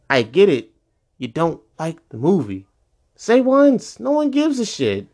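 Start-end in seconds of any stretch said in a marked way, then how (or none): 3.2-5.1 exasperated rant. Real, not acted. Ranting at movie haters.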